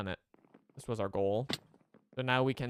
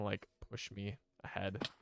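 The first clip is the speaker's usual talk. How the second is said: ordinary talk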